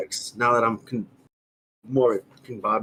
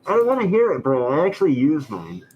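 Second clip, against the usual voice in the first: muffled through a mask, raised. Real, not acted. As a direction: talk from behind a mask. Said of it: "you sound like the teacher from Charlie Brown".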